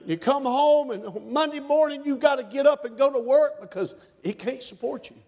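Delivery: ordinary talk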